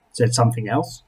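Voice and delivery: usual speaking voice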